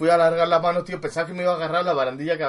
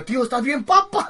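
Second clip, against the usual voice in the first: matey pat-on-back; 8 semitones higher than usual. Retold or imitated. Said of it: "Pues al tío le dije".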